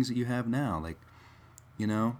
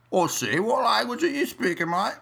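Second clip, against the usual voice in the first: high, hammed-up British accent; squeaky mock Brit asking what language this is; 12 semitones higher, 4 dB louder than usual. Brit asking an American what language, in a mock British accent. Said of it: "their accent is so thick".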